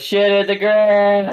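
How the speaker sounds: put-on high, loud, drawn-out kid's shout; gleeful child. Small child parroting a swear word, gleeful shout. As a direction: blurt it out slowly like a proud toddler. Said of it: "Hunter just goes".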